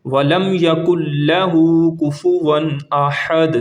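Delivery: slow chanted verse; slow tajweed recitation; Quranic recitation. Shown as a demonstration. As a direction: stretch it out melodically. Recites verse before translation.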